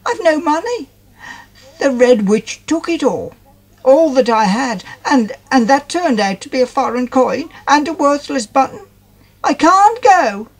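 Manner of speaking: high, weepy and pleading; tearful. A narrator's reading. Molly telling Whistler of the Red Witch, weeping. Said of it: "And Molly started to weep".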